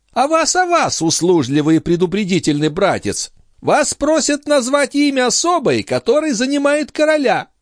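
high-pitched lady's voice, complaining about her brother; indignant countess. Acted. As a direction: play a high, exasperated countess.

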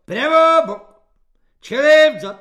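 very high, very loud drill commands; squeaky shriek. A narrator's reading. Corporal drilling his squad, squealing the commands. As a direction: shriek out the orders in a piercing squeal. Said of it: "pišťal svobodník Halík".

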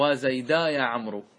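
slow connected model of وزيدا يا عمرو; joined, ha dropped. Shown as a demonstration. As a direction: say وزيدا with long aa and no h. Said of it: "لو وصلت تنحذف هذه الهاء".

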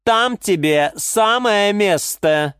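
high, slow, scornful verdict; cutting put-down. A narrator's reading. Orchid tells geranium where it belongs, scornful. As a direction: land the insult slowly, word by word. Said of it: "припечатала орхидея Галатея".

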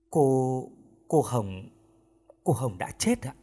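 faltering, with pauses between restarts; hesitant, understated grief; halting bad news. A narrator's reading. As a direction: break the death news haltingly, slightly hushed. Manager breaks news of a death.

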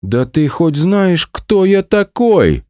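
high, slow, outraged bluster; indignant exclamation. Acted. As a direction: shout it in drunken outrage. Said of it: "воскликнул Онегин".

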